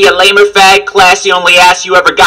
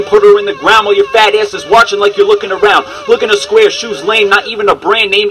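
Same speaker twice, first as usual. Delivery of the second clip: usual speaking voice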